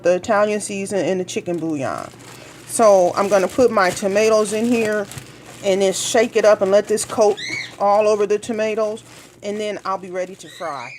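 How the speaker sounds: usual speaking voice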